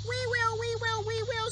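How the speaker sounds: high eager chorus of animals; eager shouting animals. Acted. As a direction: cry out eagerly, all together. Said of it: "shouted all the animals".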